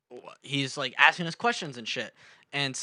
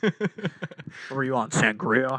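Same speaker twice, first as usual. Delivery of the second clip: mock cop voice; 2 semitones lower, 3 dB louder, much slower than usual. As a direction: question them with slow mock authority. Mock cop questioning drunk kid.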